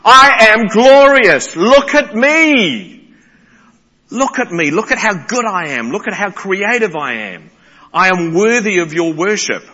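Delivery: loud, slow and high proclamation, easing down; voice of God. Retold or imitated. Preacher voicing God calling out to creation, grand and slow. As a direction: boom it out as God calling to be seen. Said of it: "God is saying".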